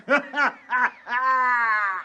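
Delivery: wild cackle. Acted. Intruder cultist laughs after smashing plates.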